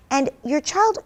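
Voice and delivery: ordinary talk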